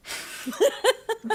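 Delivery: high laugh. Real, not acted. Laughing at own slip.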